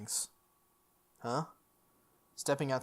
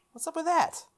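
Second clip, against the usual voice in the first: incredulous; 14 semitones higher than usual. Real, not acted. Reacting incredulously to empty street.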